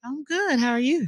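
gracious hostess welcoming a guest; society hostess. Acted. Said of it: "that's that hostess voice right there".

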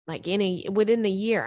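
normal talking voice

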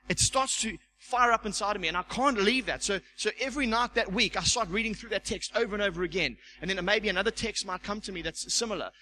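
normal talking voice

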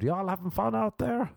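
high-pitched country concert singer's call; pumping up crowd. Acted. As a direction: work the crowd without extra volume. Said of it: "He's pumping up the crowd".

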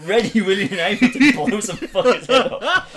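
loud laughing while talking. Real, not acted. Laughing excitedly about Beru.

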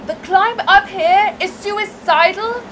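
loud, breathless shouting from afar; calling out. Acted. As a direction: shout it across the hillside, frazzled. Calling out after a steep climb.

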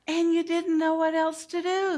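put-on understanding voice to a child; empathetic. Shown as a demonstration. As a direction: reflect the feeling gently. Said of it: "Say that with me".

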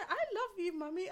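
put-on toddler's sweet voice. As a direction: say it like a tiny boy. Quoting her toddler.

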